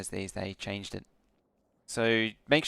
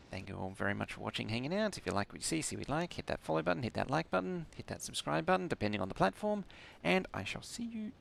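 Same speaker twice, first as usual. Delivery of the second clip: higher sing-song sign-off; streamer outro; 4 semitones higher, 4 dB quieter than usual. Acted. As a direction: rattle off the outro lines.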